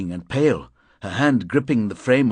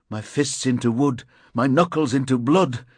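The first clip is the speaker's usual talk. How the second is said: ordinary delivery, nothing marked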